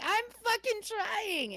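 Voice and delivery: high, drawn-out wail of protest; mock yell. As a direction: re-enact a helpless yell, stretched and high-pitched. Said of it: "I'm screaming and laughing".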